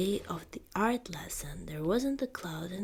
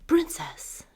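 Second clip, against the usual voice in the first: startled whispered gasp; 10 semitones higher, 9 dB louder than usual. Acted. Housemaid startled to see the princess at chores, gasping. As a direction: catch the breath on the word. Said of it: "gasped a housemaid".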